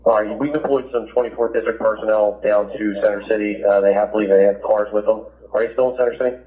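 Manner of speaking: ordinary talk, nothing marked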